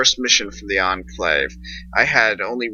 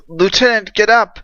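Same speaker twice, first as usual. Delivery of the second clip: high urgent call to wake someone; 12 semitones higher, 5 dB louder than usual. Acted. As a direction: shake him awake with your voice. Private shaking dazed lieutenant awake.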